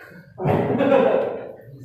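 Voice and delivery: breathy laugh. Real, not acted. Speaker laughs at student.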